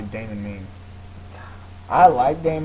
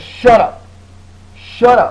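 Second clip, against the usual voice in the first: loud, higher, curt command; yelling shut up; 6 semitones higher, 7 dB louder, slower than usual. Acted. Host imitates friend yelling at gamers, loud curt interruption. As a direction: cut them off with a raised voice. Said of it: "I start yelling".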